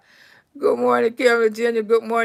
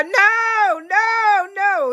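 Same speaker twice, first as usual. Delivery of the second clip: frantic refusal; 8 semitones higher, much slower than usual. Retold or imitated. Wife frantically refusing 911.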